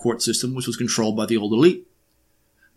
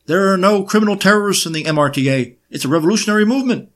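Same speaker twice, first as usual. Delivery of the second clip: higher and louder mock yell, rushing the end; mock courtroom scream; 8 semitones higher, 5 dB louder than usual. Defendant shouting her defiance at the court, hammed-up mock yell. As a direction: ham up a defiant shout from the dock. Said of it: "in her Yiddish accent screaming in court".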